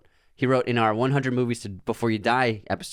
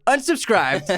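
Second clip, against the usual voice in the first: high, loud mock verdict; teasing sign-off; 12 semitones higher, 4 dB louder, slower than usual. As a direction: announce it like a game-show host. Jokey verdict.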